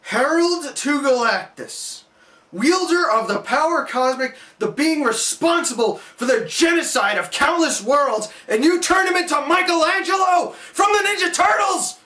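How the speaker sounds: grand titles rising to an outraged shout; building outraged rant. Real, not acted. Ranting about Silver Surfer's treatment.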